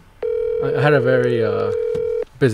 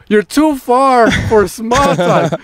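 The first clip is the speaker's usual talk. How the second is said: high, slow mock holler; imitated shouting back; 10 semitones higher, much slower than usual. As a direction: stretch every word out so it carries across the yard. Shouts back across the yard.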